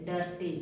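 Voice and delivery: डर्टी said as "durty", short uh first vowel; डर्टी with schwa, dropping softly; quiet model of डर्टी; correct vowel. Shown as a demonstration. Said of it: "इसको डीर्टी नहीं पढ़ेंगे".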